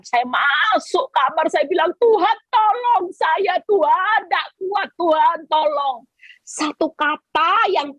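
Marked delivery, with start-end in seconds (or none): 2.0-6.1 slow, higher pleading cry with a sob; begging God for help, near tears; desperate plea. Retold or imitated. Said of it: "saya teriak sama Tuhan". (permohonan putus asa sambil menangis)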